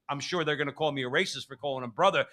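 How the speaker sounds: ordinary talk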